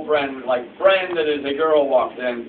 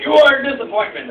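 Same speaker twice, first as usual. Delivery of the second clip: loud, high, fast rebuke; mock-stern ancestral outburst; scolding ancestor; 8 semitones higher, 5 dB louder, faster than usual. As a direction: berate him like a disgraced forefather.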